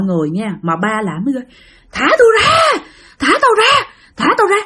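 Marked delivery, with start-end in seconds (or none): 1.9-4.6 retold high-pitched frantic howl; imitated screaming. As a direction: act out a captive hollering to be let go. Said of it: "ba gào lên quá trời luôn".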